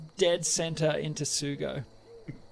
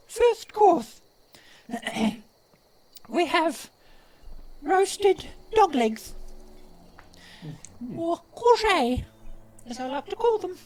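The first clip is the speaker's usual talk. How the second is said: proud goblin chef; 13 semitones higher, 6 dB louder than usual. Acted. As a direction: present each dish grandly in a high goblin voice. Goblin host announces the menu.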